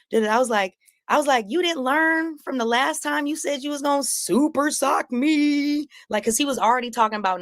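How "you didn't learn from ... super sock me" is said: high, mocking taunt. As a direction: tease him from way up high. Taunting Corey in the street.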